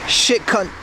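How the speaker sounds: put-on Australian insult; imitating Aussie swearing. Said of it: "with Aussie".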